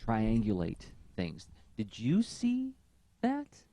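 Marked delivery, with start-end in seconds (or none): 1.8-3.6 high squeaky voice. Retold or imitated. Mimics colleague asking, high squeak.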